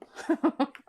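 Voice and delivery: short emotional laugh breaking through; teary laugh. Real, not acted. Reader overcome while reading cards, teary laugh. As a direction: laugh through welling tears.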